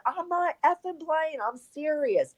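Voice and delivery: high, urgent holler without much extra volume; retold holler. To a friend who thinks it's a prank, hollering. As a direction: yell it so he finally believes you. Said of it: "literally I had to holler".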